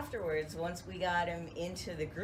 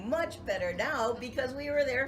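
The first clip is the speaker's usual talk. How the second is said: higher, brighter happy father; cheerful old dad; 6 semitones higher, 5 dB louder than usual. Retold or imitated. Dad greets visiting family, higher and cheerful. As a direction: beam like a content old man. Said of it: "he's like".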